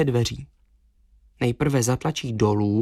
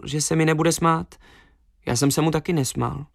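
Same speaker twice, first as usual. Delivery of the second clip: usual speaking voice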